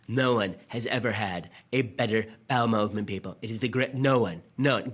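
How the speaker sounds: lightly voiced boastful Trump, hammed-up superlatives; mock him bragging, a touch over the top; a hint of Trump boasting to a crowd; mock Trump impression. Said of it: "President Trump is the kind of fellow who likes to say".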